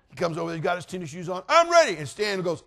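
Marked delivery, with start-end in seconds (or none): 1.5-2.0 put-on high, loud kid's yell; eager shout. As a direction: announce it like an eager boy. Eager boy announces himself.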